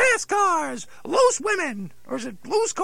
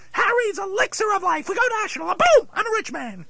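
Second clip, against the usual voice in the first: excited; 4 semitones higher, 5 dB louder than usual. Acted. Dummy pitching grand plan.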